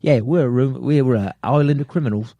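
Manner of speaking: put-on Australian accent starting to fade; hammed-up, slipping Aussie accent. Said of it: "it starts to fade".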